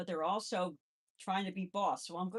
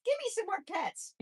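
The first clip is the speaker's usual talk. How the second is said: much higher, louder squeaky demanding pet voice; guinea pig voice; 6 dB louder than usual. Retold or imitated. Voicing her guinea pig begging for pets, high and squeaky. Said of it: "He said".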